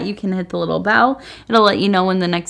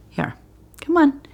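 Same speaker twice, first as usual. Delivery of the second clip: coaxing the cat; 9 semitones higher than usual. Real, not acted.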